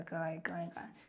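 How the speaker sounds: trailing off into a whisper while searching; fading mock search. Teacher pretending to search the map, fading to a whisper.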